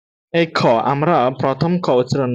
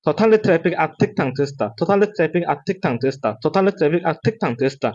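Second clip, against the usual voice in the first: run-together tongue twister in rounds; quick practice; slower than usual. Shown as a demonstration. Teacher shows learners the speeded-up drill, quickly. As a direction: rattle it off without gaps. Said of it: "ঠিক আপনারা এভাবেই পারবেন".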